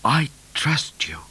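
slow, breathy whisper. A narrator's reading. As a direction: confide it slowly to your horse. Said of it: "whispered Midnight".

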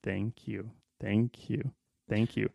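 soft, hushed thank yous; mock thank-yous. Pretend fan softly thanking a celebrity. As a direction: murmur the thank yous while patting a pocket. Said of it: "pat their shirt pocket and go".

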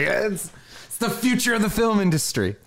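very high, strained comic outburst; mock freaking out. Film fan pleading with an indifferent wife, freaking out. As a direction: exaggerate a film fan's exasperated freak-out. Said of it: "I start freaking out".

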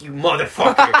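a low mock-angry retort, lightly acted; pissed off. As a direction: play the offended friend, half-joking. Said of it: "he's all pissed at me".

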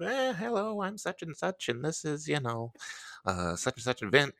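high, soft put-on voice of a sweet old woman; old lady voice. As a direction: mimic the elderly host introducing herself. Imitating old lady in red dress.

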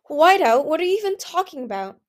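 high, loud exasperated question; incredulous protest. A narrator's reading. Listener's embarrassed incredulous protest.

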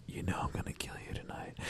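whispered threat, breathless and fast; mock-menacing whisper of a prank; creepy whisper. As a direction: hiss it in her ear like a bogeyman.

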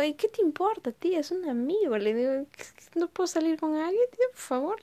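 quejándose, mucho más aguda; hija indignada. Retold or imitated. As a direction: protesta exasperada contra la tía. Hija indignada con la tía. (sobrina harta de la tía chismosa, voz aguda e indignada)

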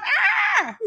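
sudden high-pitched yelp; a startled, piercing cry. Pretend. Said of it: "that's what Pac-Man feels".

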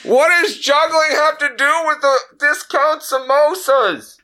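grouse at the juggler like a fed-up shopper; grumpy customer. Acted. Customer snapping at a juggling busker, high put-on voice.

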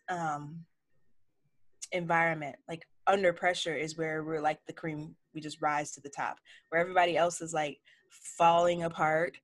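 ordinary delivery

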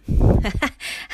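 put-on loud, high burst of old-lady laughter; big amused guffaw. A narrator's reading. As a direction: throw back a hearty cackle. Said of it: "Luise lachte laut auf".

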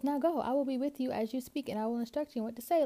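high-pitched, insistent retold order; lightly acted God, urging him on; insistent command. Acting God sending Moses off.

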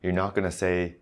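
ordinary talk

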